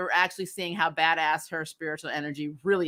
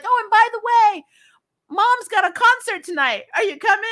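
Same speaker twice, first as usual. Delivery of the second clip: put-on much higher, eager girlish Mei voice; excited Mei; 5 dB louder than usual. Mei greeting the returning girls: high, eager gushing. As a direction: gush it like a thrilled young girl. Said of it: "And she's like".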